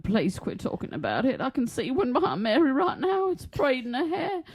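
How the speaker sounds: imitated pleading. Imitating a woman who sees one, mock pleading. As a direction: voice climbing high, hammed-up plea. Voicing someone fearful of fairies.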